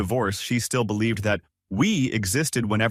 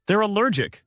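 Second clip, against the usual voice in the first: mildly irritated, higher-pitched retort; snapped; 10 semitones higher, 3 dB louder than usual. A narrator's reading. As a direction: snap it lightly, pitch raised. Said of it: "she snapped".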